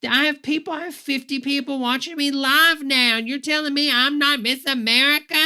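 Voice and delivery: loud, high, outraged whining; indignant mock rant. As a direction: play a sore loser demanding the crown. Mocking Trump's indignant outburst.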